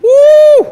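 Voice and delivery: long, very high hyped-up "woo"; high sustained mock-excited whoop; loud whoop. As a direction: whoop loudly as if the drama just kicked off. Hyping up the dramatic plot twist.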